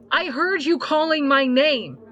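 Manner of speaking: high, loud, shaken and angry; trembling voice. Acted. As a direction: fight to keep the fear out. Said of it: "unable to control the tremble in her voice".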